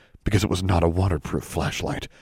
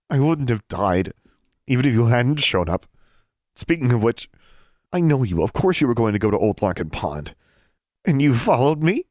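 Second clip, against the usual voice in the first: indignant; 5 semitones higher than usual. A narrator's reading. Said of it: "trembling drained the indignation from her voice".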